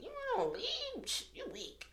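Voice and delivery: squeaky slow jeer, imitating past self; retold mockery. Judging other people who take leave, retold mockery.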